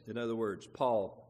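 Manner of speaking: ordinary talk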